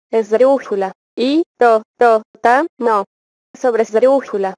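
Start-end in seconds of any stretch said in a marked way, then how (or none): 1.2-3.0 teacher breaking a word into syllables. Shown as a demonstration. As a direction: separate each syllable with a small gap. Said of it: "dividir en sílabas las palabras".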